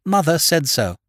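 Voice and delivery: high, loud comeback; retort. A narrator's reading. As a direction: shut the objection down. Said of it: "retorted her ladyship".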